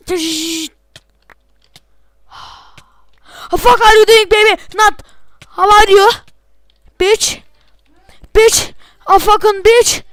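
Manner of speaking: high loud put-on English curses; hammed-up foreign gamer rage; mock English yelling. Gamer ranting in put-on English at the enemy, high mock yelling.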